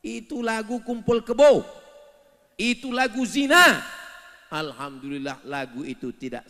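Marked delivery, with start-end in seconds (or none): 0.1-3.8 high, loud denunciation; loud condemnation. Real, not acted. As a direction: hit the last word hard. Preacher condemning songs. (kecaman lantang)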